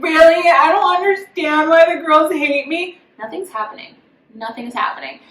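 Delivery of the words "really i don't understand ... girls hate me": higher, put-on wounded innocence; mock contestant. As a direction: imitate her playing the victim. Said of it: "I'm just like".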